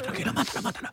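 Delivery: hushed, breathy whisper with a laugh; dad whispering go ahead to his son; whispered encouragement. Retold or imitated. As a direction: whisper it so nobody hears. Dad hushing son's hookup.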